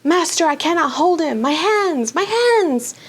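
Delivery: high, panicked cries of pain; howling in agony. A narrator's reading. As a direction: cry out to his master in burning pain. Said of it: "howling in agony".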